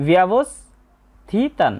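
ব্যবস্থিতান very slow with the half স before থ; correct Sanskrit pronunciation. Shown as a demonstration. Teacher demonstrating correct Sanskrit pronunciation to students, very slow. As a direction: say ব্যবস্থিতান slowly, স only half sounded. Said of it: "তাই এর শুদ্ধ উচ্চারণ হবে".

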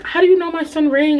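high, sugary mom voice; lightly voiced nosy mom; a hint of sweet mom. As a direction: play the curious mom, lilting up high. Mom asks again.

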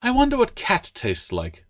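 high, dreamy gecko musing; a gecko musing wistfully about eating cats. A narrator's reading. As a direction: muse aloud, longing a little. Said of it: "the gecko speculated wistfully".

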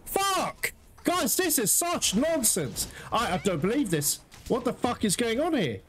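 high, strained outburst at the game; real anger. Real, not acted. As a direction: vent at the monster in rising fury. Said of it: "I don't mean to get pissed like this".

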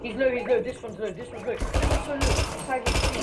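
urgent high yell to the squad; combat callout. Real, not acted. Urgent callout on low enemy.

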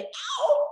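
high, sharp yelping ow like a hurt puppy. Shown as a demonstration. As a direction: yelp it like a wounded puppy. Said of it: "like a puppy".

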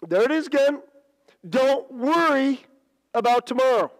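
ringing pulpit delivery, pitched high; emphatic preaching. Real, not acted. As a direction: drive the verse home to the congregation. Preaches the verse at force.

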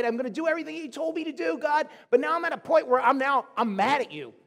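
acting out a loud, heated protest; angry arguing. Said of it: "he's having an argument with God".

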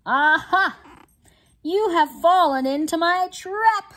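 spring the trap with glee; gloating triumph. Acted. Bismarck crowing at Tobin over the board, gleeful gloat. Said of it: "Bismarck said".

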